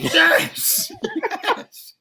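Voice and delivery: loud, high-pitched laughing yes; excited agreement. Real, not acted. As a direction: burst out laughing in delighted agreement. Delighted agreement with co-host's point.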